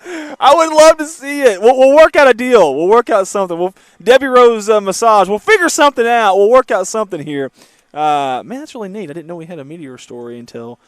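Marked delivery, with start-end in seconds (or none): 0.1-7.5 higher, louder, pleading with viewers; eager appeal. Real, not acted. Host begs viewers to find the meteor, eager and louder. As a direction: beg the audience for the space rock, loud and bright.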